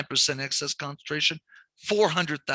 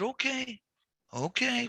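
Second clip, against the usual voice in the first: resigned; 9 semitones higher, 5 dB quieter, slower than usual. Retold or imitated. Placating the annoyed client.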